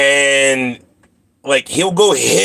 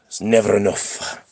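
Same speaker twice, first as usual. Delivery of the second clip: low, soft grumbling cat voice; a cat muttering that it is never enough; grumpy cat voice; 4 semitones lower, 4 dB quieter than usual. Retold or imitated. Owner voices his cat, low grumbling and soft. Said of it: "That's what Kush says".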